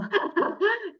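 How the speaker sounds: light amused laugh; a short bright laugh. Real, not acted. Laughing about deleting insults.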